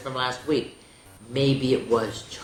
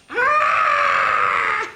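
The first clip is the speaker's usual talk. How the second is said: short mock scream; 8 dB louder than usual. A narrator's reading. Reader voices a scream.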